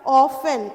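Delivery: often said loudly, the t not sounded; teacher modelling. Shown as a demonstration. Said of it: "often नहीं होता है". (ज़ोर से often, t के बिना)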